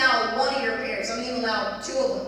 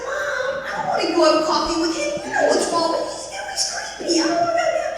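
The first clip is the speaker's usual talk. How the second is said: high-pitched pleading, climbing higher; whiny pleading; 4 dB louder than usual. Retold or imitated. Daughter begging mother not to send her, high whiny plea. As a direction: whine like a teenager begging her mom.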